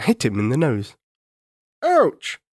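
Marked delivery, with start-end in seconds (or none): 1.8-2.4 ouch. Acted. Banana hits king's nose.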